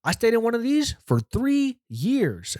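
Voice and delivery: high, slow, drawn-out inmate voice; imitated inmate, pitched high and slow; yelling inmate. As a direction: stretch it out as the hectoring inmate. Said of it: "He goes".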